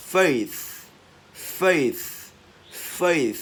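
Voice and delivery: "faith" high and loud, airy TH ending; emphatic drill of "faith"; loud released TH. Shown as a demonstration. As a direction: punch "faith" and release the final TH. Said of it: "bật càng to thì càng hay".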